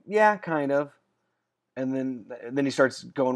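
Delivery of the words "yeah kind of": higher, louder skeptical concession; doubtful half-agreement. Retold or imitated. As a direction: act out reluctantly granting half a point. Retold doubtful reply to coach.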